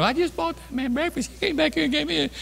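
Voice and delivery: the cashier telling the next lady in line, high and excited; excited cashier testifying. Retold or imitated. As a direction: pitch way up like the gushing cashier. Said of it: "she starts testifying to the next lady".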